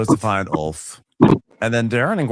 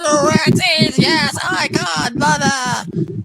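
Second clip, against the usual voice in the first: very high, slow reverent cry; mock worshipper; much slower than usual. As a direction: hail the dragon like a zealot. Guys worshipping the dragon.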